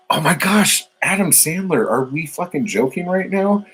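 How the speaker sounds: high-pitched mock shock; exaggerated, squeaky incredulity; mock disbelief. Mock shock at reader not knowing movie.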